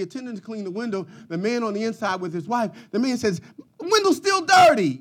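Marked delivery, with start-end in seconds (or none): 3.8-5.0 sharp raised complaint at the attendant; angry complaint. Retold or imitated. Customer shouting at the window attendant, angry. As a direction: snap at him, half shouting.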